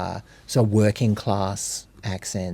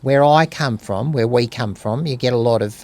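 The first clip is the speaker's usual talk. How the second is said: normal talking voice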